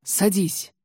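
ordinary delivery

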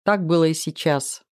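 plain reading voice

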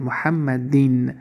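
slow محمدین ending in -din; double kasra ending. Shown as a demonstration. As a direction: say محمدین with a final -in. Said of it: "ده کسره میمونیم". (محمدین آهسته، با تنوین کسره در آخر)

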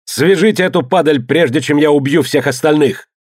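furious command in narration; roaring threat. A narrator's reading. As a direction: threaten the whole crew, voice held high. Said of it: "взревел он".

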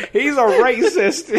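high, loud, drawn-out counter-accusation; hammed-up tattling cry, breaking into laughter; mock accuser. Second worker shouting to the boss from behind, mock accusation.

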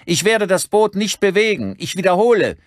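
high, loud roared announcement; megaphone bellow. A narrator's reading. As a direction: bellow it across the water as through a megaphone. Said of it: "schnappte er sich ein Megafon aus einem Schrank und brüllte zurück".

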